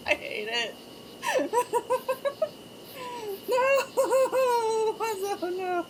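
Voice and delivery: high, laugh-broken wail of dismay; helpless laughing protest. Real, not acted. Streamer hating a joke while cracking up, helpless laughing protest. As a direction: protest through uncontrollable laughter.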